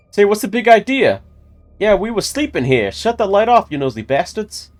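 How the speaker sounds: high, loud mobster voice; high-pitched cartoon gangster; old mobster. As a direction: squawk indignantly like an old-time mobster. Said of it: "they sounded like old mobsters".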